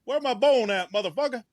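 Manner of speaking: high, put-on comic dog accent; Frank. Co-host voicing the dog demanding a bone, high comic accent. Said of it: "Be a fun accent for Frank".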